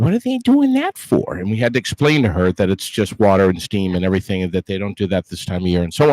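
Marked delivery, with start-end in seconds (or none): 0.0-1.4 mom voice. Retold or imitated. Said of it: "She said".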